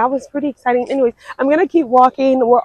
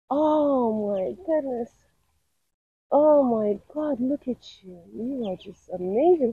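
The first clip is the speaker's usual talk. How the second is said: hushed, awed coo; soft cooing; 7 dB quieter than usual. Real, not acted. Birder to coot babies, cooing softly.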